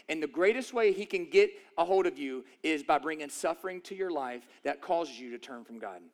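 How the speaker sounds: usual speaking voice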